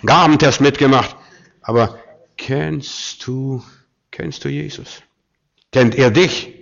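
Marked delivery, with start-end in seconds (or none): 0.0-1.1 loud, higher-pitched boasting; mock self-assured bragging; big talk. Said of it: "wir können große Sprüche reden". 1.6-5.0 drawn-out, softened challenge; slow, pointed and quiet; slow searching question. Real, not acted. Challenge after mocked boasting.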